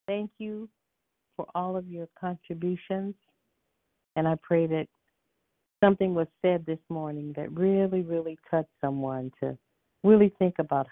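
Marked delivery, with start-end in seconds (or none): none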